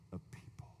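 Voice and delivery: breathy whispered aside. Real, not acted. Preacher to congregation, hushed whisper. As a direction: let the words fall to a whisper.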